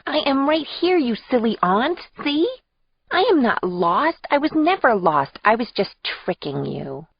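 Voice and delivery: put-on high little-girl voice, each word distinct; toddler enunciating every syllable. Toddler niece to her silly aunt, high and carefully enunciated. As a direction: proudly spell it out in a small child's high voice. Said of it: "as if she were enunciating every syllable".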